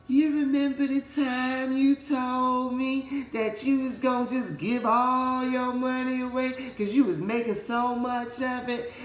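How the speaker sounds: high, slow, mocking little voice; little voice in his ear. A little voice in his ear mocks his broke self, high and slow. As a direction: taunt him in a high, slow voice. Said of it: "this little voice in my ear".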